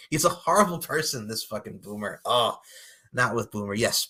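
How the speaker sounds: ordinary delivery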